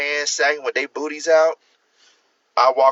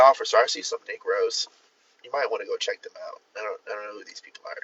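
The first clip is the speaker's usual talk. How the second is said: softer and faster, hushed nervous report; mock police tip-off; 4 semitones lower, 6 dB quieter, faster than usual. Reporting strangers to the police, hushed and hurried. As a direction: rush a hushed tip to the officer, trailing off.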